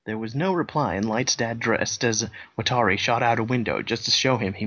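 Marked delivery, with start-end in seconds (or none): none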